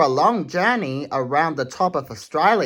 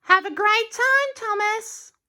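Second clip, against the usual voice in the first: very high sweet carriage voice calling out; Annie's voice. Acted. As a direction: call a cheery farewell in a high voice. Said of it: "called Annie".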